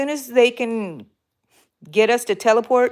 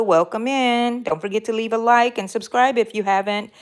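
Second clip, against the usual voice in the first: ordinary talk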